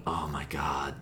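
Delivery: whispered under the breath; hushed exasperation. Real, not acted. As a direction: breathe it out, barely voiced. Dreading the MVP question.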